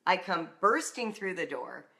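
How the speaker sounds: usual speaking voice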